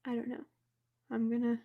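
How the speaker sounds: ordinary delivery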